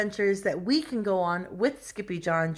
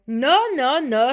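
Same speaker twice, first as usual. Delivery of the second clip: raised, loud little-dog chorus; chihuahuas correcting; 6 semitones higher, 6 dB louder, slower than usual. A narrator's reading. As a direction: deny it emphatically, high and loud.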